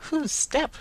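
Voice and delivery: the carrier throws the question back from the doorway; puzzled echo. A narrator's reading. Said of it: "returned the carrier".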